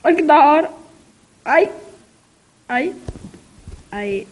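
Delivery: ordinary talk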